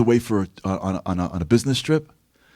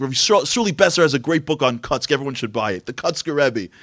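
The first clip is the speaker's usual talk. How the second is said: normal talking voice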